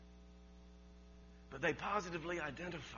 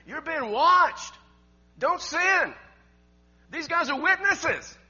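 loud, raised scripture proclamation; full-force preaching; 5 semitones higher, 9 dB louder than usual. Real, not acted. Preacher warning congregation of watching witnesses, forceful.

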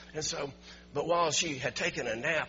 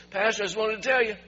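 higher and louder, thrilled report; excited good news; 9 semitones higher, 12 dB louder than usual. Retold or imitated. Daughter phoning the pastor about her mother, thrilled and loud. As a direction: deliver the miracle news with rising joy. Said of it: "She says".

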